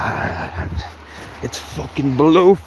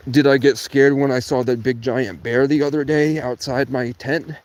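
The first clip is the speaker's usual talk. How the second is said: ordinary talk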